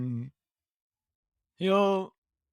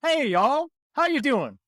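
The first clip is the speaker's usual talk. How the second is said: put-on high Southern-accented howdy; Southern drawl greeting; 10 semitones higher, 4 dB louder than usual. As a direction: greet like a Southern country fella, pitched up. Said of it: "What would his accent be like in Druidic?".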